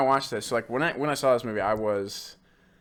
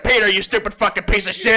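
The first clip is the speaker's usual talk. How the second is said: higher, loud put-on voice cursing the kid; mock furious rant; 8 semitones higher, 7 dB louder than usual. Uncle in the old movies cursing Peter out, loud mock rant. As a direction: bellow insults like a cartoonishly mean uncle.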